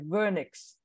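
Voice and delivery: "Vernix" as VUR-niks; teacher's corrective model of "Vernix"; pronunciation model. Shown as a demonstration. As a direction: stress the first syllable of "Vernix". Teacher models word after learner's attempt.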